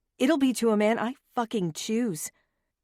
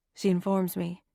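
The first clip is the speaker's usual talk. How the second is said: ordinary delivery, nothing marked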